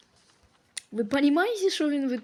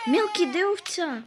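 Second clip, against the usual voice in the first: louder, demanding; 5 semitones higher, 7 dB louder than usual. Real, not acted. Demanding from the baby. (гучна вимога)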